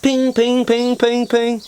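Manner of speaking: high bouncy "ping, ping" for each ball sunk; mock ping sounds. Telling the pub crowd how she sank every ball, high playful pings. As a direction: chirp out "ping" like balls dropping into pockets.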